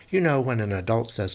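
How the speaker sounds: usual speaking voice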